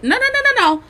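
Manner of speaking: high, loud run of no's; high-pitched insistent no no no; emphatic denial. Real, not acted. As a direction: shoot the idea down fast.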